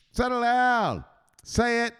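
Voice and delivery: high, insistent enforcer; Richetta. Acted. Enforcer interrupting the apology, high insistent command. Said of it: "Mister Richetta".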